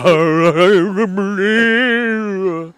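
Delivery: wordless, wailing croon, badly off; hammed-up bad crooning with garbled words; terrible mock singing. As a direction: imitate awful shower singing. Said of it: "I was singing it so terribly".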